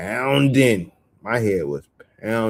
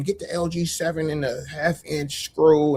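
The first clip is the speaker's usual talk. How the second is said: higher, lightly put-on voice for jargon; mocking coworker jargon; 6 semitones higher than usual. Imitating the coworker's jargon in a higher mocking voice. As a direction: mimic his technical talk mockingly. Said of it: "your little Edomite words".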